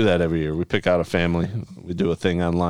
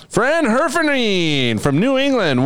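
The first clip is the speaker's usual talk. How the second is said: high, slow, drawn-out announcer call; 9 semitones higher, slower than usual. Acted. Host reading a listener's name, hammed-up announcer call.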